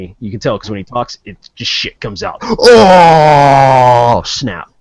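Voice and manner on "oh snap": loud, very long "ohhh"; drawn-out heckler's "oh" after a burn; mock shock. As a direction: stretch it out loud and falling. Mock shock at own insult.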